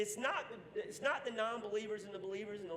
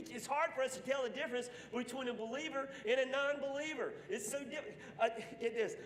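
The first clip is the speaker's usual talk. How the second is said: usual speaking voice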